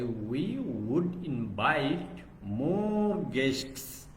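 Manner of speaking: drawn-out words, slowly spaced; deliberate, slow English for learners; slow model sentence. Shown as a demonstration. Teacher finishing a translated sentence for the class, slow and drawn-out.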